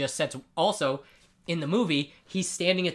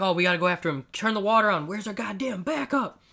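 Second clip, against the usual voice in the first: mock panic; 6 semitones higher than usual. Cops chasing Rorschach, mock panicked shouting. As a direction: act out frantic cops losing it. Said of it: "everyone's freaking out".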